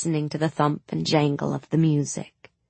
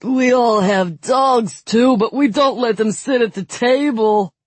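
sneering nobleman; 7 semitones higher, 6 dB louder than usual. A narrator's reading.

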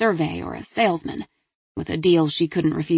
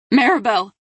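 higher, louder urgent cry of a name; frantic call; 5 semitones higher, 5 dB louder than usual. A narrator's reading. Delia calls her daughter who cannot answer, frantic. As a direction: call out anxiously, voice raised.

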